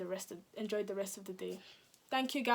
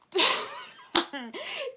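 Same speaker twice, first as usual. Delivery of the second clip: a high burst of laughter, much higher and louder; 7 dB louder than usual. Real, not acted. Laughing loudly while telling friends about lying to police. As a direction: crack up out loud at the memory.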